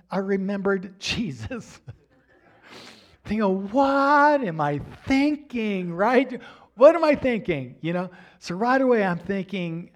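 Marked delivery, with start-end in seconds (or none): 3.7-7.8 loud, high self-reproach, first one drawn out; exclaimed realization. Retold or imitated. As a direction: scold yourself as it dawns on you. Sudden realization remembering Jesus.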